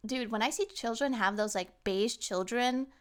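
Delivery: normal talking voice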